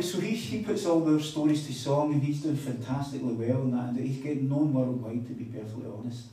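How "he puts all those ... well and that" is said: usual speaking voice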